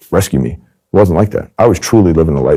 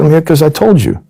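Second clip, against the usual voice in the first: much higher, pushed back hard; defensive insistence; 11 semitones higher than usual. Retold or imitated. Undercover agent answering the mobster: high indignant bluff.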